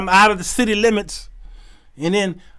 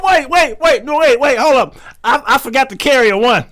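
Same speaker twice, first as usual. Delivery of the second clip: higher, louder, fast panic; frantic backpedal; 6 semitones higher, 5 dB louder than usual. Retold or imitated. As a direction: scramble to raise the price.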